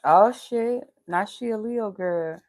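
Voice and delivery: normal talking voice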